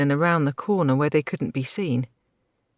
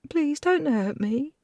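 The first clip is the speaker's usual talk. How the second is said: sobbing; 12 semitones higher, slower than usual. A narrator's reading. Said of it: "she sobbed".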